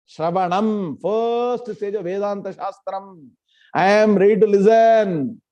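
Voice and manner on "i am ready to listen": said out with more force; louder declaration. Shown as a demonstration. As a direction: repeat it, louder and firm. Repeated louder.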